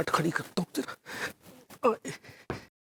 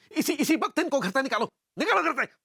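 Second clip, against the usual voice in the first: high-pitched, agitated command; angry order; 9 semitones higher than usual. Acted. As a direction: order them out, voice rising high. Husband orders the guests out. (गुस्से में हुक्म देते हुए)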